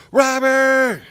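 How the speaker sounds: high, loud imitation of a wife calling; wife hollering. Wife calling her husband in from the garden, mock holler. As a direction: holler the name out the back door, imitating her. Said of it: "my wife hollers out the back door".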